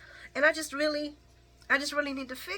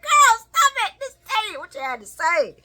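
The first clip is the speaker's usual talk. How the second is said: mock yell; 11 semitones higher, 10 dB louder than usual. Urging Nina to spit it out.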